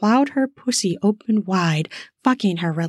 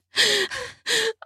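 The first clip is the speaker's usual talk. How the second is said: sharp high gasp; 4 dB quieter than usual. Acted. Woman gasps at touch.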